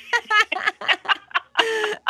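laugh. Real, not acted. Laughs after singing her tune.

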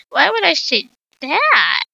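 a touch of caught-out nerves; mildly nervous teacher. Acted. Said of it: "Miss Gray said nervously".

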